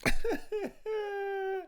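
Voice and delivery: high-pitched laugh; real, amused high laugh. Real, not acted. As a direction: laugh in a high, drawn-out wheeze at what you just heard. Laughing at the played clip.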